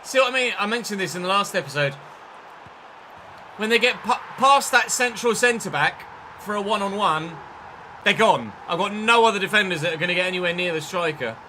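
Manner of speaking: loud aggrieved I-told-you-so rant; exasperated rant. Real, not acted. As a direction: raise your voice to vent at the defending. Ranting as a goal is conceded.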